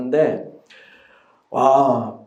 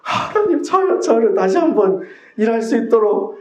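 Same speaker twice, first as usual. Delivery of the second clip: very high, loud begging cry; a man imitating his own desperate prayer, crying out high; desperate pleading; 10 semitones higher, 4 dB louder than usual. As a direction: plead like a day laborer begging to be hired. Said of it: "그런 절실함으로".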